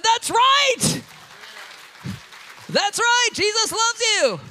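high, loud, slowing close; exultant shout. Retold or imitated. Preacher affirming the healed lady, a high joyful shout. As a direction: proclaim it with joy.

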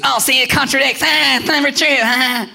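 high, loud mocking jeer; mock it in a put-on taunting voice; taunting enemy. The enemy to a hard-hearted believer, mock taunting. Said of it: "the enemy came along and goes".

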